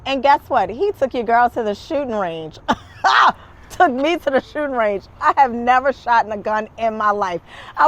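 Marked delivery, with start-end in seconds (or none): 2.6-3.3 quick high giggle at her own line; bright laugh. Real, not acted. Host laughing at her own tease, bright giggle.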